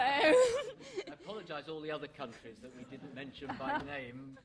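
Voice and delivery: laughing through the apology. Real, not acted. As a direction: laugh while you speak. Host laughing while apologising.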